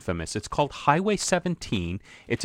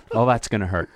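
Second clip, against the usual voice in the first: ordinary delivery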